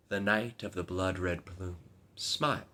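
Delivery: usual speaking voice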